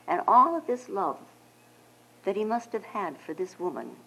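ordinary talk

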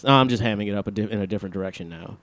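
low, soft, serious radio voice; NPR podcast voice. Acted. [name] slips back into his podcast voice, low hushed radio host. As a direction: lean into the smooth public radio host. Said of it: "Oh, I'm doing it again".

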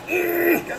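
high strained ugh of pain; pained groan. Retold or imitated. Pissing so hard it hurts: high pained groan. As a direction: squeeze out a high pained grunt.

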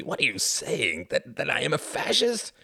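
outraged protest rising to high shouting; indignant cry. A narrator's reading. The Count denies being a fascist, indignant crying out. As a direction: build from disbelief to loud, high outrage. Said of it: "cried Count Orski".

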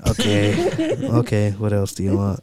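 low, resigned voice; weary clerk. Retold or imitated. Drive-through worker taking the silly order, low and resigned. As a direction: imitate a clerk putting up with it. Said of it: "like, sighed".

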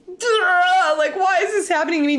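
high, loud, hammed-up whine; mock exasperated wail. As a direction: wail it like a put-upon fan. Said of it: "and I was like".

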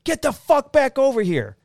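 high shouted order across the street; yell. Retold or imitated. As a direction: call him back with a yell. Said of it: "So I yell".

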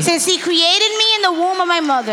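preaching build. Acted. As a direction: lift it like the preacher climbing. Interpreter preaching at full force.

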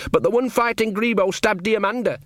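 put-on timid young lad knocking at a door; nervous boy. Sean calling through the door to Magrat, nervous and high.

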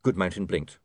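ordinary delivery

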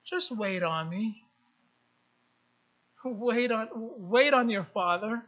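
soft, low, patient; soothing inner voice. Retold or imitated. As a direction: hush the puppy in your head. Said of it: "And I'm thinking".

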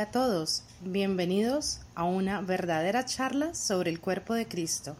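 switching languages mid sentence. Acted. Recorded show intro, English then Spanish.

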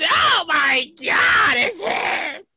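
long, drawn-out mock sob of awe; hammed-up weepy amazement; tearful fan. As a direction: imitate an overcome fan, stretching every word. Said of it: "people was over there shed tears".

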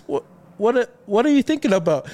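light high quiz of a curious wife. Retold or imitated. Husband voices his wife asking what he's thinking. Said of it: "and she goes".

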